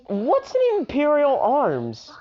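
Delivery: put-on high, baffled boy's question; confused question. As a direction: ask it with puzzled disbelief. Izuku confused by term.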